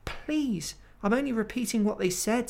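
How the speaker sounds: high, fast, frightened stammer. Acted. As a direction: stammer out an excuse. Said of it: "stammered Edmund".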